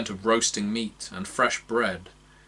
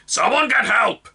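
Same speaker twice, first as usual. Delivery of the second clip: much higher, loud gruff shout; 8 dB louder than usual. Acted. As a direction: call out over your shoulder to the crowd. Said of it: "it shouted over its shoulder".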